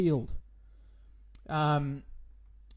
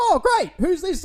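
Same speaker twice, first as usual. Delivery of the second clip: exasperated surprise; 12 semitones higher, 9 dB louder than usual. Acted. Another caller, exasperated.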